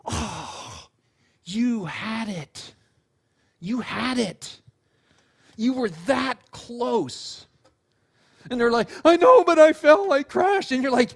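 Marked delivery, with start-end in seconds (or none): 0.0-7.5 very slow, drawn-out tender consolation; hushed-style comfort, voiced and unhurried; whispered encouragement. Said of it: "you whispered in their ear". 9.0-10.7 high, loud, whiny protest; distressed kid voice, pitched way up; upset child. Retold or imitated.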